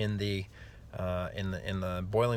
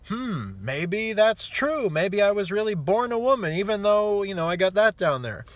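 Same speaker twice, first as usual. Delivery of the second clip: dopey millennial man; 11 semitones higher, 8 dB louder than usual. Retold or imitated. Host mocking a millennial, in a high dopey voice. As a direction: play a gullible man who believes anything, high and loud. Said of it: "Millennial man goes".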